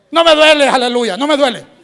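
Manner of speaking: loud, high, emphatic denial; full-voiced preacher's declaration of victory; defiant shout. Models defiant answer to the devil.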